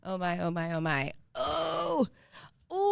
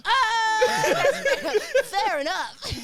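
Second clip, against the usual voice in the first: laughing; 14 semitones higher, 5 dB louder, much slower than usual. Real, not acted. Reacting to joke.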